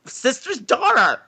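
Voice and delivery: high-pitched, appalled mock yelling; yelling at screen. Retelling yelling at the film about cousins, high appalled protest. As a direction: protest with comic disgust, voice jumping up. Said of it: "I yelled at the screen".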